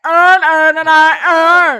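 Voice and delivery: very loud, exaggerated Baltimore accent. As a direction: shout it in thick Baltimore, slurred to "urn". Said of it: "if you have a Baltimore accent".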